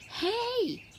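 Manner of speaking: put-on high call to the boy; yell. A narrator's reading. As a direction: call across the water. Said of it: "I yell to the boy".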